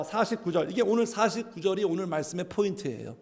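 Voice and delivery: usual speaking voice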